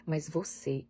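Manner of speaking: usual speaking voice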